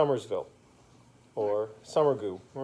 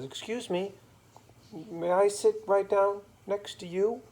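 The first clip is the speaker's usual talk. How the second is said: lightly voiced, higher-pitched polite gentleman; a higher, courteous storybook voice; polite Mister Magoo; 7 semitones higher, 3 dB louder than usual. Mister Magoo asks to sit by the crying girl, polite.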